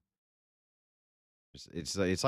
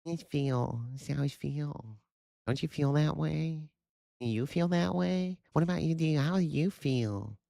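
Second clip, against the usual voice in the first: mocking whiny voice; 10 semitones higher than usual. Mocking a feelings talker asking how you feel, high whiny.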